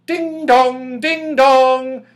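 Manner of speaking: high, far-off ringing ding dong; clock's chime voice. A narrator's reading. The clock chimes outside the locked door, high and ringing. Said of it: "said a voice softly".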